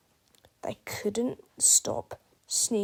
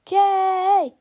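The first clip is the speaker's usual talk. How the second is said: loud high shouted letter; crowd shout; 10 semitones higher, 11 dB louder than usual. Acted. Fans echo the cheerleaders, loud shout. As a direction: yell it back like the whole crowd. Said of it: "repeated the fans".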